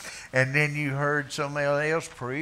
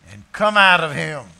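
Jesus rebuking a demon; rebuke; 7 semitones higher, 12 dB louder than usual. Acted. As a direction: command the demon out. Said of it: "Jesus rebuked him".

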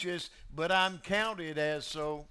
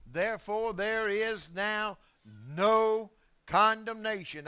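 Therefore, there is now no condemnation, drawn out slowly; slow emphatic quote; 3 semitones higher, slower than usual. Shown as a demonstration. Preacher savouring the verse, slow and emphatic. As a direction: linger on each word, lean on no. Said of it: "preacher repeats verse slowly".

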